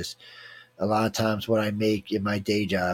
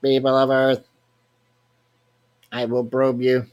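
booming alien voice, a little higher and louder; alien overlord voice; 3 semitones higher, 5 dB louder, slower than usual. Pretend. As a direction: play the skull as a grand alien lord. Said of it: "This is Bob".

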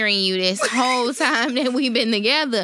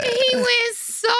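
laughing; 12 semitones higher, slower than usual. Real, not acted. Laughing while retelling.

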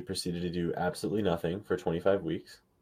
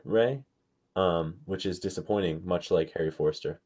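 normal talking voice

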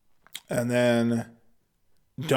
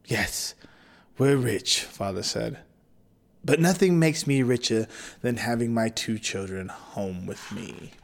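tender father; 4 semitones lower, 5 dB quieter than usual. Acted.

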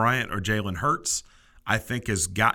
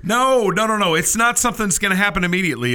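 yelling across the room; 9 semitones higher, 6 dB louder than usual. Real, not acted. Rushes to protest co-host's complaint.

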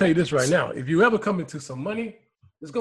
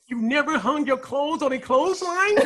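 higher-pitched, incredulous quoted question; quoting Puerto Rico; 7 semitones higher than usual. Retold or imitated. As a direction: imitate his indignant question, pitched up. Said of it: "what Puerto Rico said was".

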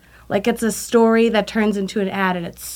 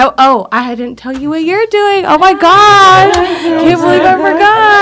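excited; 8 semitones higher, 8 dB louder than usual. Real, not acted. Realizes she forgot instructions.

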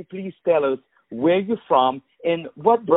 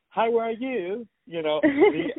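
higher, light mock British accent; imitating a British accent; 12 semitones higher, slower than usual. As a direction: copy her English way of asking. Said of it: "I love your accent".

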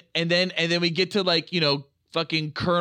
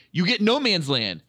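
usual speaking voice

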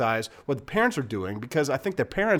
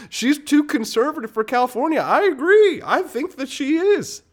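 much higher, louder mock concession; sarcastic agreement; 14 semitones higher, 5 dB louder than usual. Real, not acted. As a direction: agree in a way that means the opposite.

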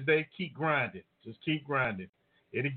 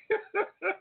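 short high-pitched laugh; quick amused chuckle. Real, not acted. Host unsure about next Monday's show, laughing.

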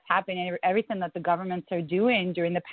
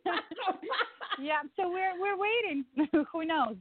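high giggling through the words; laughing; 10 semitones higher than usual. Real, not acted. Guest laughing helplessly about the coming lockdown. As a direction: laugh it off nervously.

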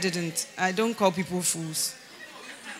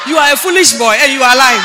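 shouted accusation, pitch near usual; loud rebuke; 2 semitones higher, 14 dB louder than usual. Retold or imitated. Mentor accusing the young man of lying, shouted rebuke. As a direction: shout the verdict at him.

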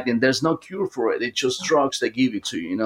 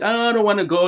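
put-on higher-pitched voice of a reluctant child; 7 semitones higher than usual. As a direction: imitate a small child refusing. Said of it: "She changed her voice for a, a child".

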